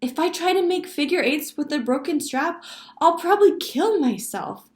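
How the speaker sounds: put-on high, loud little-girl complaint; girl interrupting. A narrator's reading. Sally cutting in about her broken skates, loud interrupting child. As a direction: butt in loudly as an aggrieved child. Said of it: "When Sally interrupted".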